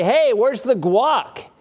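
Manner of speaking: hammed-up loud demand, drawn out; mock all-caps demand. Rude houseguest demanding guac, mock loud. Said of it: "in all caps?".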